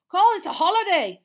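very loud, high angry roar. A narrator's reading. Boy roaring at the common room. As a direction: bellow at the room. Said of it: "Seamus Finnegan roared".

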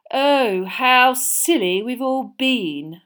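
very slow, high mocking sneer. A narrator's reading. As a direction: drip sarcasm slowly. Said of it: "Malfoy sneered".

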